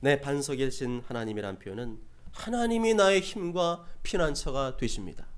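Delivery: ordinary talk, nothing marked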